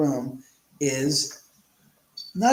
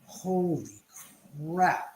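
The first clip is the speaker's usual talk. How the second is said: drawn-out, hushed retold amazement; mock stunned; 6 dB quieter, slower than usual. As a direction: lightly imitate someone stunned, with a pause mid-phrase. Retells the interviewer's stunned reaction.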